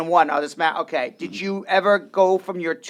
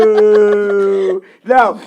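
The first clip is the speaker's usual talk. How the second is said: one loud, long-held "no"; mock-exasperated no; 7 dB louder than usual. Telling co-hosts the train never sped up, mock exasperation. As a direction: draw it out loudly in comic dismay.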